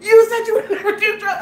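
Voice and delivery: high, punchy retold tirade; mock furious scolding. Drunk girlfriend pummels her boyfriend, imitated furious shouting. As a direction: scold between imagined blows, amused underneath. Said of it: "pummeling him".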